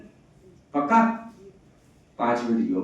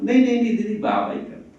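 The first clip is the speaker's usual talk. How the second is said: repeated नहीं pitched up high, coy and pleased; hammed-up false modesty; 11 semitones higher, 3 dB louder, faster than usual. To the sister praising her, hammed-up coy modesty. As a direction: brush off praise while loving it.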